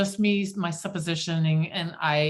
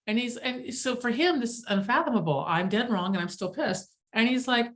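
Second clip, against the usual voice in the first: ordinary delivery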